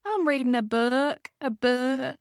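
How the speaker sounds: put-on drawn-out, high country accent; slow country twang on "book". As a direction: imitate a little kid's thick country accent. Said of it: "having this really country accent saying".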